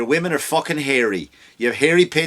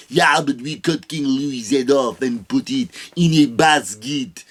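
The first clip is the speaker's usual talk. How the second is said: slow, lightly put-on French accent; a smug Frenchman bragging, slowly; mock French voice; 3 semitones lower, slower than usual. Quoting a smug French reply.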